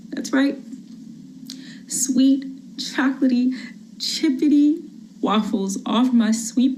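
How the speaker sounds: gentle, sweet-talking bribe; pleading mother. Acted. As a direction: coax the gun out of his hand.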